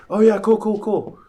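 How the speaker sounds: high, breezy imitation of a coworker; mock careless agreement. Mover brushing off the customer's warning, high breezy mock. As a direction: toss it off like you aren't listening.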